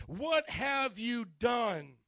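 very slow, drawn-out demand; terrified accusation. Acted. Terrified sailors confront Jonah, slow drawn-out accusation. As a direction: stretch out each word of the question.

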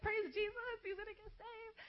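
high, thrilled self-quote; excited. Retold or imitated. Telling friends her crush might get saved, excited. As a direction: gush with joy at the news. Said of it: "I was like".

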